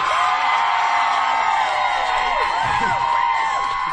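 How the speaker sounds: high, loud whooping laugh-scream; shrieking laughing scream. Real, not acted. Speaker whooping after her slogan, shrieking laugh. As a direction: let out a wild cheering shriek.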